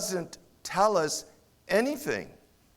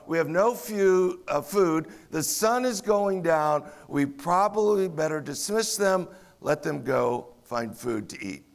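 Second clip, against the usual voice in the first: ordinary delivery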